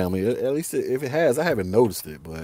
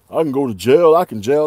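louder, mimicking a jail-loving thug; mock boast; 8 dB louder than usual. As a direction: imitate a guy boasting loudly. Mimics dudes who love jail.

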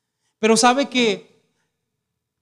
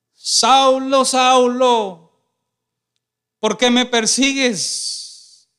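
calling voice; 3 semitones higher, 5 dB louder, much slower than usual. Acted. Heavenly voice calling Saul on the road, loud and drawn out. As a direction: call down from heaven, slow and booming.

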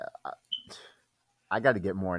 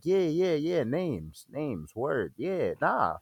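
faking it; 7 semitones higher, slower than usual. Retold or imitated. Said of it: "and I'm like".

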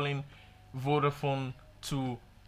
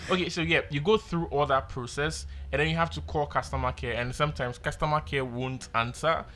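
ordinary talk, nothing marked

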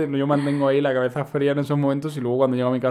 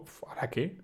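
whisper; 12 dB quieter than usual. Retold or imitated. Unsure on dark stairs.

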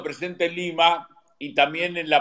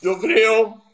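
chewing while talking; 5 semitones higher, 4 dB louder than usual. Real, not acted. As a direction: mouth full of food. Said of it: "perdón, estaba comiendo".

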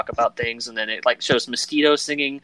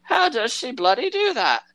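much higher mock grumble; old pirate baffled by her hearing; 11 semitones higher than usual. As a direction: mutter in disbelief. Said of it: "and he just goes".

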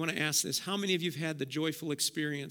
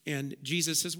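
ordinary talk

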